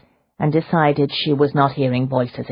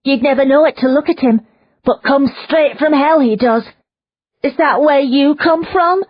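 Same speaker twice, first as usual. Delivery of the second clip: high, loud, half-crazed woman's voice; a captive woman rambling about a demon; frightened prisoner woman; 12 semitones higher, 6 dB louder than usual. Acted. Prisoner warns Leona about her captor, high and agitated.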